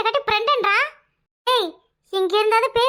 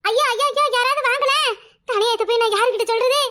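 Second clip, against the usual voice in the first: mock outcry; 4 semitones higher than usual. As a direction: wail it out like a scandalised sister-in-law. Shocked sister-in-law cries out.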